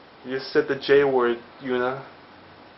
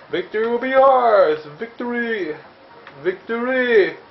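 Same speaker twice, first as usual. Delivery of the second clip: hammed-up cry, higher and louder; rally the troops in jest; high mock war chant; mock battle cry; 9 semitones higher, 3 dB louder, slower than usual.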